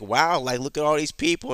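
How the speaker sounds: lightly voiced amazed woman, pitch lifted; a touch of awe; mildly impressed. Acts out impressed woman seeing crowd.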